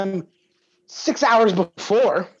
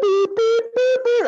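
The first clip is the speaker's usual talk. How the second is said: high, clipped beep beep; high mock dial tones; imitated dial beeps; slower than usual. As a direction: mimic phone keys being dialled.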